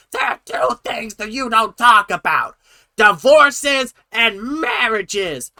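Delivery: high, loud imitation of someone yelling; mock shouting. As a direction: mimic a shouted telling-off, dragging the last words. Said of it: "you are shouting at these children".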